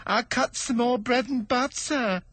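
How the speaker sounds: high, slow stammering plea; timid hatter begging pardon; nervous hatter. Acted. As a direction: stammer it out, afraid of the king. Hatter continues.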